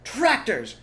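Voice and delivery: loud high alarmed shout; alarmed shout of a fox father. Acted. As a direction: yell the warning. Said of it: "shouted Mister Fox".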